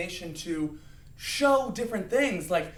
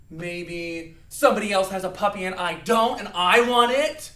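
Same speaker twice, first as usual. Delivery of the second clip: petulant anger; 8 dB louder than usual. Shown as a demonstration. Acts reason for being angry.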